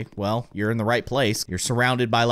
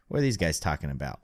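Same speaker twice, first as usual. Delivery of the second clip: normal talking voice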